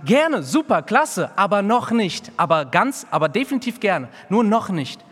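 high, loud mock cheerful put-offs; mocking eager excuses. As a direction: parody the people's eager "not yet". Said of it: "sie sagten".

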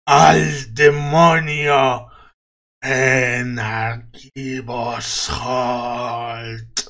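slow roaring voice from the grave; furious undead shout. Acted. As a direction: bellow it like an enraged corpse. Undead guardian shouts warning. (grito de ultratumba furioso)

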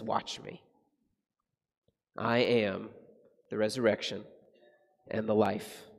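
calm him with a soft promise; Jesus answering the doubter, softly and low; quiet assurance. Acted. Preacher voicing Jesus's quiet assurance.

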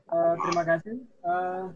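distant, off-mic voice; speaking too far from the mic. Real, not acted. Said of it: "suaranya agak didekatkan mic-nya".

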